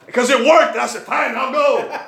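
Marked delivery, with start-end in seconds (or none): 1.0-2.0 a chuckling, grudging yes; laughing surrender. Retold or imitated. To Sam Gibb, finally agreeing to visit, laughing. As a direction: give in with a laugh, pitch up. Giving in to a friend's invite.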